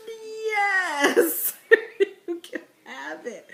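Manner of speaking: high, loud mock sob on the yes; hammed-up crying surrender; mock anguished yes. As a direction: pretend it breaks your heart to give it away. Giving up her sweet tea.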